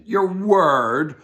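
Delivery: put-on captain scoffing at a child's promise; scoffing disbelief. A narrator's reading. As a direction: scoff at the very idea.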